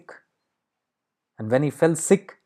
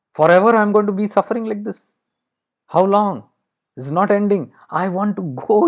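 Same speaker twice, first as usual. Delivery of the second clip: high-pitched, anguished outcry; desperate plea; 7 semitones higher than usual. Acted. Crow begging to leave its endless life, desperate plea. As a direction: plead for an end, voice raised in pitch. Crow wants its suffering to end.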